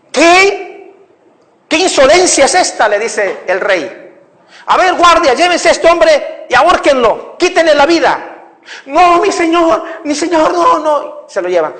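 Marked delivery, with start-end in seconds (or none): 0.1-2.9 high, loud outraged king; indignant king. Acted. Preacher voicing the king's fury at the seer. Angry king in sermon story. 4.7-8.5 high, loud royal order to the guards; commanding king. Acted. King ordering guards to hang the seer. 8.8-11.2 pleading. Acted. Condemned seer begs.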